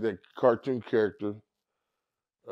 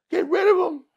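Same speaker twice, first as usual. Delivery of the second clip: much higher, louder frantic pleading; panicked high-pitched Cat; 7 dB louder than usual. Retold or imitated. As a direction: beg Suge in a frantic squeak. Cat begging again.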